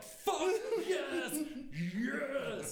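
high, loud, laughing yes; laughing shout of joy. Real, not acted. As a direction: shriek with delighted disbelief. Learning buyer was Migos.

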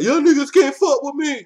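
loud boastful taunt; cocky shout. Pretend. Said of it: "he come out and be like".

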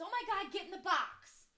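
high, loud, slow exasperated outburst; mock road rage. Cut off on the off-ramp, mock exasperated yell. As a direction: imitate a driver fed up with another. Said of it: "He's basically telling this old person to, to die".

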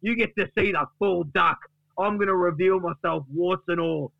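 high, grandly put-on announcement; defiant Duck. Duck promises Gary he will redeem himself, defiant boast. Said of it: "And Duck says".

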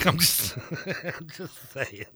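laughing through the words. Real, not acted. Host brushing off his own cheerleading joke, laughing. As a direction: chuckle your way through it, dragging it out.

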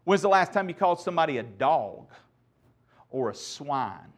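ordinary delivery

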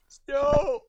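high-pitched cry, drawn out; called-out exclamation. Acted. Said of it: "from you going".

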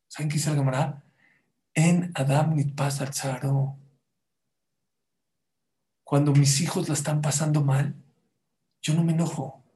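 normal talking voice

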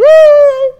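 loud, very high mock scream; long shrill pretend shriek from behind the walls; high-pitched scream. Acting frightened behind city walls.

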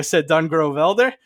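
ordinary talk, nothing marked

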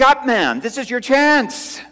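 high, loud cry to the church; urgent call. Real, not acted. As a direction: throw it out as a challenge. Opening call to the congregation.

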